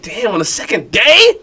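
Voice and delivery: high, slow, dragged-out dismay; dismayed surprise. Retold or imitated. Kid dismayed on being told he gets benched. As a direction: whine at the unfairness of it. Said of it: "I'm like".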